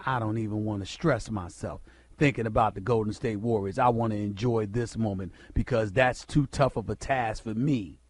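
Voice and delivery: low, soft mock of a weary LeBron; imitating LeBron, mocking. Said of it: "Here's what he said".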